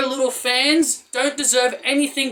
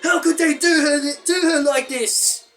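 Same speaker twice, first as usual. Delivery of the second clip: whiny fan mockery; 7 semitones higher than usual. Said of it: "everyone was tweeting".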